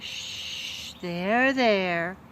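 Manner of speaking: put-on calm her like a child; soothing hush. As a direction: Louise soothing the crying cook. Louise soothes cook.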